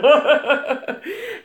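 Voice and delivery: high rippling giggle; real amused laugh. Real, not acted. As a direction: crack up at a comment just read. Amused by a viewer's comment.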